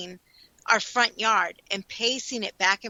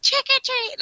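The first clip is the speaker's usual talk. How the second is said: put-on high, singsong little girl voice; the prank girl's cheery higher call; imitating the little girl; 13 semitones higher than usual. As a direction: mimic the girl's sweet trick-or-treat call. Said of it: "and then she goes".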